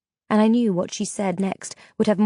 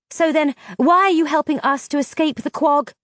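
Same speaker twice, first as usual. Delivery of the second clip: demanding; 6 semitones higher, 3 dB louder than usual. A narrator's reading. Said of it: "I demanded".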